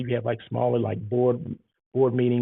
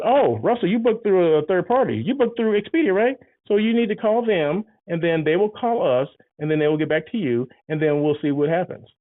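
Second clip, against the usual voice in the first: higher, louder sing-song brush-off; high fake-polite clerk, bit louder; dismissive desk clerk; 6 semitones higher, 4 dB louder than usual. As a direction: play the clerk passing the buck cheerfully. Clerk brushing off OTA guest.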